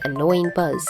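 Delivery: ordinary delivery, nothing marked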